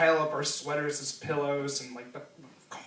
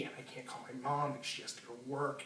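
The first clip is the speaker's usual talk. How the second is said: hushed; 2 semitones lower, 12 dB quieter, faster than usual. Retold or imitated. Friend at locked door at 3am.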